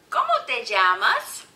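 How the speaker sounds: loud, clearly projected question; big, bright-eyed question to young learners; teacher modelling a phrase. Shown as a demonstration. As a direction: ask the class loud and clear.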